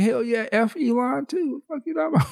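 high gleeful taunting employee, laughing; gleeful worker. Retold or imitated. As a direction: crow like a worker living large. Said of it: "I'd be like".

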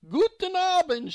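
put-on high, slow German old lady greeting; high joyful German greeting; old woman's joyful cry. A narrator's reading. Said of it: "she cried".

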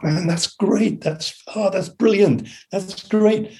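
high-pitched retold enthusiasm; imitated delight. Bassist praising the track he just heard, imitated delight. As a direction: lift the voice high, imitating someone thrilled.

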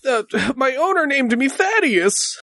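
lightly voiced timid horse; a hint of a nervous young horse; shy talking horse.

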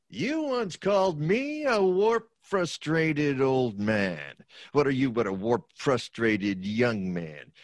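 Potter's sneer. Acted. Potter mocking George who begs for help, sneering.

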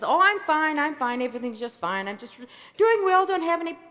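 loud, rushed brush-off: everything's fine; fake cheerful. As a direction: cover it up with a loud, bright act. Bill faking being fine.

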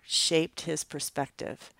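usual speaking voice

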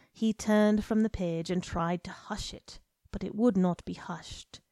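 ordinary delivery

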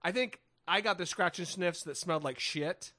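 ordinary talk

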